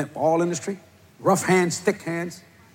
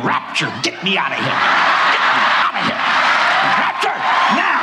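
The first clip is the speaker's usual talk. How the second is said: desperate prayer; 4 dB louder than usual. Retold or imitated. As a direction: beg for the rapture, slowing. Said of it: "I'm praying".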